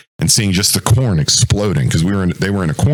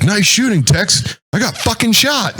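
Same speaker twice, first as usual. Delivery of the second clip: high, sarcastic quip; wry wounded buddy; 13 semitones higher than usual. Retold or imitated. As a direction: toss it off half-joking though hurt. Wounded squad leader greeting.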